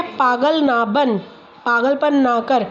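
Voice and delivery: slow modelling. Shown as a demonstration. Modelling slow sentence for child.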